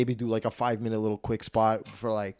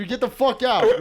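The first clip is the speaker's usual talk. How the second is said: high mock ejection yell; umpire shout; 12 semitones higher, 4 dB louder than usual. Doorman throws the husband out, mock umpire shout. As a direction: toss him out like an umpire. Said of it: "You're like a fucking umpire".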